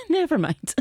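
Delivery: high start, giggling and falling; amused, giving up with a laugh. Real, not acted. Laughs off own failed escape.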